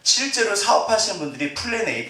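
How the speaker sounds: normal talking voice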